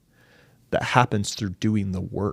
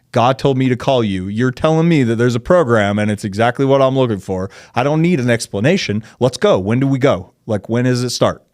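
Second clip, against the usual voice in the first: loud, higher, rallying insistence; retold urgent demand at full voice; insistent urging; 3 semitones higher, 8 dB louder than usual. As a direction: press them hard, eager to get going. Retells his urgent, insistent phone call.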